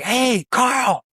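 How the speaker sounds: high-pitched distant holler; calling over wind. Retold or imitated. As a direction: call out across the water over the wind. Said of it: "barely I could hear him, the wind".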